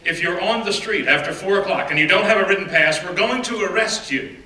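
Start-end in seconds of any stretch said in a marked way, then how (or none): none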